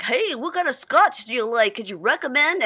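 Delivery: imitated chat-up. Imitating a man asking strangers about scotch, mock chat-up.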